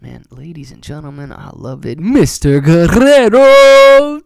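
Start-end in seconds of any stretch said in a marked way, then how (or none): 2.0-4.2 drawn-out, much louder and higher call of the name; hype call. Real, not acted. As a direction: announce him like a ring announcer. Hyping a donor's name on stream.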